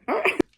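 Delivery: high, loud giggle; burst of laughter. Real, not acted. Laughing about dad babbling on the phone.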